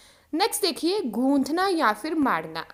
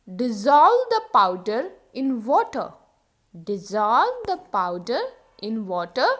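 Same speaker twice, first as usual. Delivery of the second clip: slow drill for learners; slow model sentence; 4 semitones lower, much slower than usual. Shown as a demonstration. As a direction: dissolve the powder in water said very slowly. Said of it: "घोल दो". (धीरे-धीरे बोलें)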